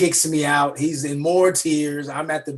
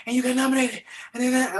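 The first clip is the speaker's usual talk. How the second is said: mom breathless; 10 semitones higher, much faster than usual. Retold or imitated.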